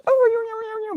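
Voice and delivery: high startled "Oh"; mock surprised oh. Child realising the dog bites, startled high oh. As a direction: pitch the oh way up in alarm. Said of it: "And then I go".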